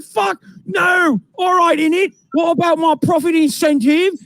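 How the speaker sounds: put-on high, shouting London accent; shouted mock British accent. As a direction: protest loudly like a British salesman. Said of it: "they're speaking in English in the U K".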